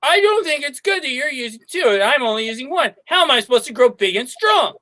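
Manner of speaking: put-on high, whining little boy; whiny kid. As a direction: complain like a small child. Twin child whining at brother.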